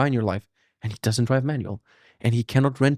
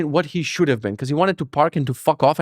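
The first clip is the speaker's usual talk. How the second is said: ordinary talk, nothing marked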